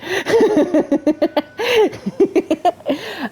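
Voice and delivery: long high-pitched giggle; tickled laughter; giggling. Real, not acted. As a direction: break into a sustained high laugh. Laughs about partner's search help.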